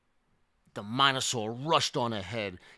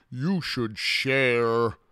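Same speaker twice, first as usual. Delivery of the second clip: slow, louder, higher character voice; drawn-out dinosaur scolding; 4 semitones higher, 6 dB louder, much slower than usual. A narrator's reading. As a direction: draw it out as a slow reproach.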